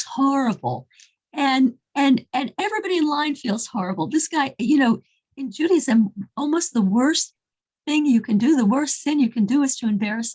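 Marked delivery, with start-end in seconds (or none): none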